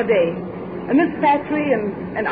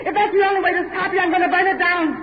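ordinary talk, nothing marked

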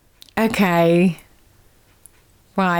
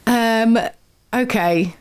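usual speaking voice

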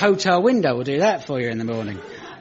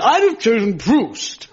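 highbrow Mark Lawson impression; 8 semitones higher, 5 dB louder, slower than usual. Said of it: "he would have said".

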